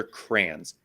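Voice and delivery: crayons squashed into "crans"; one-syllable crayons. Shown as a demonstration. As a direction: say crayons as "crans", rhyming with fans. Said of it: "It should be spelled C R A N".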